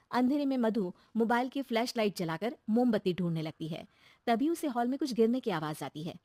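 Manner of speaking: plain reading voice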